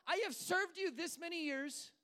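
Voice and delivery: high, loud mock tirade; frustrated with God. Believer listing good deeds to God, frustrated shouting. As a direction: shout each item as an aggrieved complaint. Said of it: "when we're frustrated with God".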